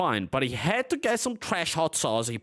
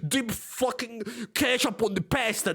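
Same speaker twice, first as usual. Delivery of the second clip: mocking quote; 7 semitones higher than usual. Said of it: "when it's Italians' food".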